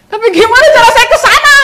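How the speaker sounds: high, loud, rushed yell; shouted question. Retold or imitated. Lost woman asks God the way, shouting. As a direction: shout the question in helpless alarm. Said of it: "teriak-teriak di jalan lah ya".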